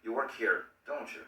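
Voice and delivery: "don't you?" falling to confirm; sure, settled tag; falling intonation. Shown as a demonstration. Teacher contrasting with a confident falling tag. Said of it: "es así o estoy casi seguro".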